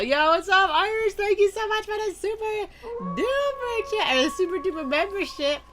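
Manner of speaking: very high, loud thanks; overjoyed shout-out to a donor; excited. Real, not acted. Thanking a big super chat.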